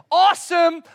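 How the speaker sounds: high loud awesome; pleased exclamation; eager buyer's cheer; mock delight. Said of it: "this one brings you salvation".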